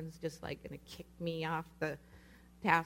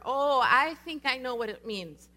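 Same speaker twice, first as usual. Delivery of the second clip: raised, higher voice for everyone to hear; praying loud enough; 4 semitones higher, 6 dB louder than usual. Retold or imitated. Praying over the speaker, backpedaling out of fear, loud and insistent. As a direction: pray it out loudly over her, emphatic. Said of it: "as I prayed over her loud enough".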